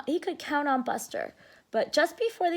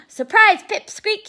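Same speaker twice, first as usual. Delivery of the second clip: menacing bully; 8 semitones higher, 9 dB louder than usual. Acted. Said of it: "he whispered".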